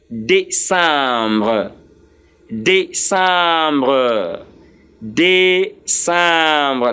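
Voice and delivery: December stretched out as French day-sahm-bruh; French pronunciation. Shown as a demonstration. Teacher models the last month of the list, December in slow French. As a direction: say December very slowly, syllable by syllable, nasal "sahm". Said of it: "the last of this list".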